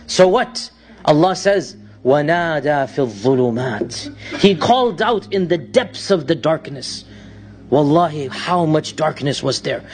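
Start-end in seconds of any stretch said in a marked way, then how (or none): 2.0-4.4 Quranic recitation. Acted. Preacher quotes the Quran.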